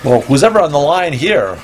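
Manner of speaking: ordinary talk, nothing marked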